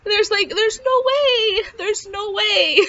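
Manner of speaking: high, slow, choked-up retelling; tearful disbelief. Retold or imitated. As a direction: voice climbs high as if crying with relief. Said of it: "I just started crying".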